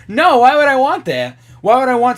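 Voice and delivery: loud, high, incredulous protest; emphatic refusal. Real, not acted. Rejects the option after laughing.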